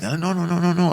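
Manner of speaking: high, rapid string of refusals; imitated urgent warning. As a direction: act out warning someone off in a hurry. Driver warns him about snakes.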